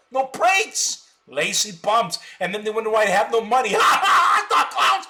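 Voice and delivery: loud, high jeering with laughs; shouting insults through a laugh; mocking laughter. Real, not acted. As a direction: laugh and jeer at them. Mocking viewers, laughing loudly.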